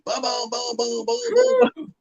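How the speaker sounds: high, rattled-off vocal gunshots; imitated gunfire. Storyteller imitating friend unloading his pistol, high gunshot booms. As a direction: mimic emptying a whole magazine.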